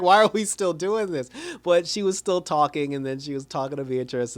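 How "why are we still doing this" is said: exasperated. Acted. Mock exasperation at drawn-out plot.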